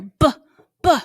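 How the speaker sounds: B said as short lip pops; b, b sounded out for kids; isolated b sound. Shown as a demonstration. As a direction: pop out b with closed lips. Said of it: "My mouth does something totally different there".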